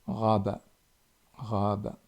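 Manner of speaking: "Raba" with a gargled ghain, not a French r; teacher models the ghain in "Raba"; Arabic ghain. Shown as a demonstration. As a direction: roll the back of the throat for "Raba".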